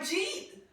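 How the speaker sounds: "Jeet" as one rising syllable, high and laughing; Queens slurred "Jeet". Retold or imitated. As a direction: repeat "Jeet" for did you eat, amused. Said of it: "the way we say it at home in Queens".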